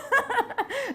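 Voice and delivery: high, loud peal of laughter; real laugh. Real, not acted. Teller amused by the guru's joke, high hearty laugh. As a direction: laugh hard at your punchline.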